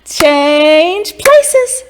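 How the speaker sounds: gleeful fairy call. A narrator's reading. Grinning fairies call to each other, loud and cheeky. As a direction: call it out mischievously. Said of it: "grinned, and said".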